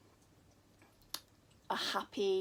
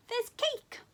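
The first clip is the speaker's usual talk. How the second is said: mole's punchline. Acted. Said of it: "said the mole".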